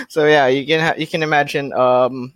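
ordinary talk, nothing marked

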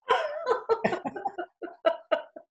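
a burst of high delighted laughter. Real, not acted. Guest laughing at her own nightly martini joke, high laugh.

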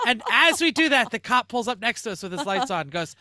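normal talking voice

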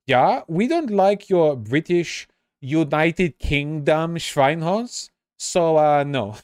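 slow, high, mock Dutch accent; lightly put-on Dutch accent. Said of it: "That's his Dutch accent".

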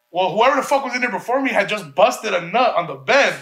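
ordinary delivery

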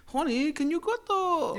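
high-pitched mock English female; English woman impression. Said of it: "a female that talks like this".

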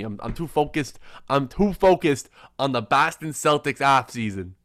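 put-on Boston accent with flattened vowels. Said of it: "no more good Boston in me".

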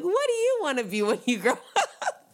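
put-on high, sing-song voice for a kid; sweet talking to a child. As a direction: coo at a little kid, laughing. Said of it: "I just wanna be like".

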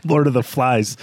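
ordinary delivery

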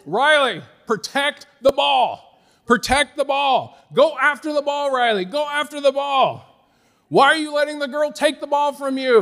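high, loud sideline parent shout; re-enacted frantic coaching yell; yelling across the floor. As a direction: holler urgent orders to a child on court. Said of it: "I'm yelling across the floor".

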